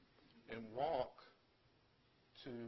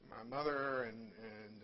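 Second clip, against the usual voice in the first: normal talking voice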